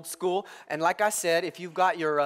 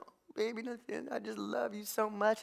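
soft, higher cooing; baby talk; 3 semitones higher, 10 dB quieter than usual. Retold or imitated. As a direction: coo over the baby girl. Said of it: "I'm like".

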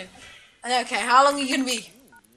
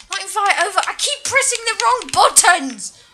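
exasperated; 7 semitones higher, 4 dB louder than usual. Real, not acted. Frustrated at pressing wrong buttons.

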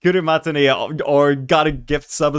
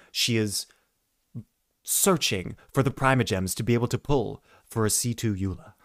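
softer, hushed wildlife documentary voice; nature documentary narrator; 3 semitones lower, 7 dB quieter than usual. Retold or imitated. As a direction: narrate quietly so as not to spook the animal. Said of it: "National Geographic going on".

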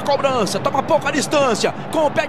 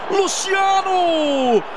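stretched name shout; 3 semitones higher, much slower than usual. Real, not acted.

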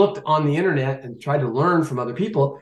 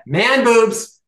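higher-pitched blurted punchline; comic blurt; 7 semitones higher, 4 dB louder than usual. Real, not acted. Guest giving in to the host's urging, comic blurt. Blurts answer after being egged on.

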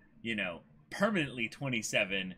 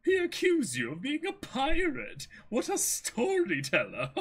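much higher, chuckling butler voice; amused butler; 11 semitones higher than usual. Acted. Merry to Kuro, chuckling in a much higher voice. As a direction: laugh it off fondly.

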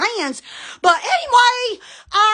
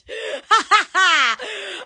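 real burst of ha-ha laughter; cackling laugh. Real, not acted. Host amused by her own pose, cackling. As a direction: crack up at your own bit.

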